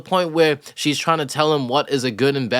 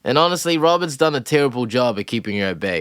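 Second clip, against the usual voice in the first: usual speaking voice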